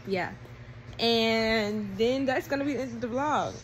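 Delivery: ordinary delivery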